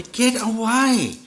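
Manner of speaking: high, slow sharp shout at the dog. Real, not acted. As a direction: yell the dog away. Dog's nose on the microphone.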